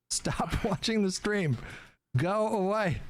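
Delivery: ordinary talk, nothing marked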